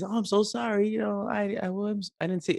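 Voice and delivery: put-on high, soft child's apology; apologetic little girl. Eleven-year-old apologizes to the angry man, soft high voice. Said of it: "she immediately is".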